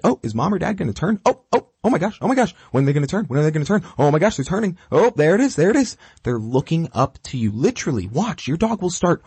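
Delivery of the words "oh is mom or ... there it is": much higher, fast, giddy dog voice; a puppy who can hardly wait for the turn; excited dog. Retold or imitated. Trainer voicing a dog on a walk, giddy and high. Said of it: "Your dog is looking to you to be like".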